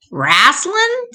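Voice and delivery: high, rising question; puzzled echo. A narrator's reading. Sister questions Milton's word, high puzzled voice. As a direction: repeat it in baffled disbelief.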